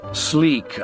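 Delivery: usual speaking voice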